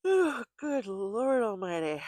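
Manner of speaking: big yawn through the words. Real, not acted. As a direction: stretch it out on a huge yawn. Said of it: "as I yawn my way through".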